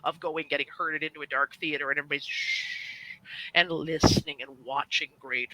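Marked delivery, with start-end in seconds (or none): 2.3-2.9 long hissed "shh"; imitated shushing. Audience herded into dark theater, imitated shush. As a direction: hush a room with one long shush.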